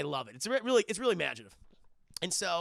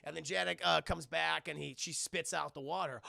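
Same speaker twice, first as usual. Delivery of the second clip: normal talking voice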